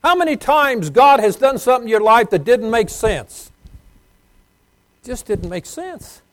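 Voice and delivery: high, loud preacher's cry; preaching at full force. Real, not acted. Preacher to congregation about God's ways, full-force preaching. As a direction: lift the crowd with it.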